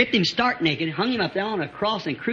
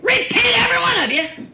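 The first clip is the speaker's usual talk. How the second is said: ringing pulpit call; preaching cry; 13 semitones higher than usual. Real, not acted. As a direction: cry it out over the congregation. Preacher cries out repentance.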